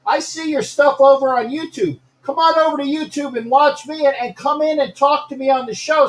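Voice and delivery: high-pitched, eager invitation; excited hailing. Real, not acted. Host inviting a viewer over to YouTube, excited hailing. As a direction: call them over in a high, lively voice.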